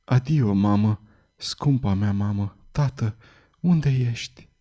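soft, slow, barely audible farewell; half-conscious victim's faint plea; faint mumbling. A narrator's reading. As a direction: murmur it weakly, hardly getting the words out. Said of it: "Cuvintele abia bolborosite".

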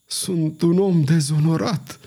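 mildly faltering, drawn-out admission; stammered out. A narrator's reading. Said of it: "bâigui Bussy Leclerc".

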